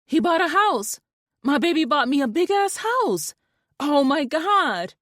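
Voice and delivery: high, loud squeal of joy; overjoyed. A narrator's reading. Wife telling her friend about the new house, overjoyed. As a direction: burst with disbelieving joy.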